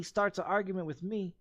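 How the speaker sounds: ordinary talk, nothing marked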